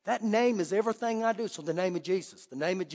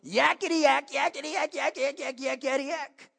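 mock demon chatter; 4 semitones higher than usual. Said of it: "starts going".

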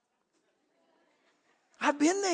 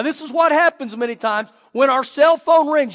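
normal talking voice

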